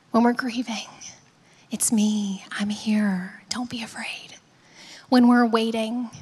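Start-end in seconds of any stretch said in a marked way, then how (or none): 1.7-4.4 low, hushed comfort; gentle reassurance. Acted. Jesus to those facing life's hardest, tenderly reassuring. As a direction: soothe softly, almost whispering.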